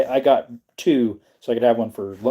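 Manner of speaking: usual speaking voice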